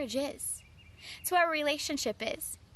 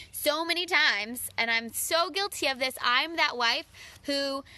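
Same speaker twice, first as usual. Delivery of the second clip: normal talking voice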